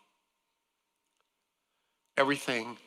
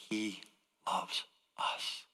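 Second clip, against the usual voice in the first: a tender whispered close; hushed whisper; 3 semitones lower, 10 dB quieter, much slower than usual. Real, not acted. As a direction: drop to a soft whisper on "loves us". Tender hushed point about God's love.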